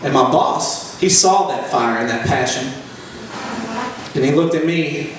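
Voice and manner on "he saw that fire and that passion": ordinary talk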